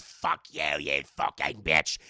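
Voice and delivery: deep, loud, slow curse; low possessed growl; demonic spirit. Retold or imitated. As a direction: spit it out like a demon.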